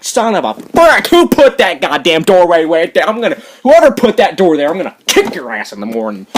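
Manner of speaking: loud, hammed-up outburst of rage; acted cursing rant. Vlogger acting out a midnight toe-stub, loud cursing rant. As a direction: rant furiously as if you just stubbed a toe. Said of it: "what do you say?".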